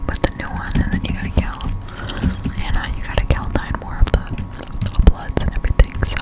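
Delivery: normal talking voice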